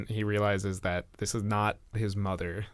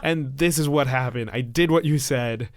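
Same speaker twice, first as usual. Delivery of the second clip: louder, higher raging yell; screaming in rage; 4 semitones higher, 8 dB louder than usual. Acted. Ron screaming at the creature he thought was his mother, raging yell. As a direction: scream it at her with the hurt boiling over. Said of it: "screaming at this alien creature".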